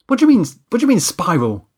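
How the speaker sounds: high, loud, quick-fire challenge; defensive. Acted. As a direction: throw the question back in rattled protest. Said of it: "I said defensively".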